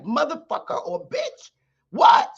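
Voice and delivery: high-pitched acted indignation, jumping on the last word; indignant attitude. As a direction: act affronted, ending on a high, loud challenge. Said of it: "my attitude is like".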